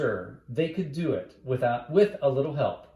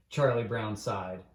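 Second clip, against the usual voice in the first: ordinary delivery, nothing marked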